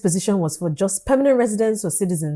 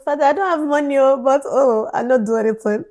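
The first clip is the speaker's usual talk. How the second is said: put-on higher-pitched whiny baby girl plea; 6 semitones higher, 3 dB louder than usual. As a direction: plead like a helpless little girl. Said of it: "feel like a baby girl".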